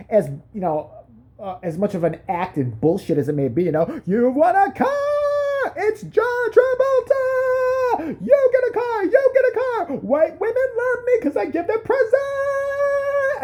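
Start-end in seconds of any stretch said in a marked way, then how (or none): 4.1-13.4 shrieking high giveaway shout, slow and drawn out; Oprah impression. Comic mocking talk-show host shouting prizes at the audience. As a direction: scream it like a talk-show host handing out cars.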